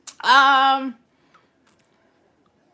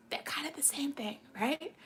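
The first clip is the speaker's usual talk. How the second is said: whisper; 3 semitones higher, 14 dB quieter than usual. Real, not acted. Whispered aside.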